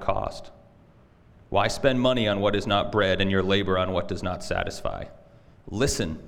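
low, measured reading of scripture. Acted. Pastor reads Isaiah to congregation before prayer, solemn scripture reading. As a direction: read it solemnly, pitched low.

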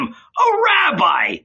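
high, slow, derisive shout; scornful howl. A narrator's reading. Judas deriding Jesus before Peter, scornful howl. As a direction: stretch the title out in a high shout.

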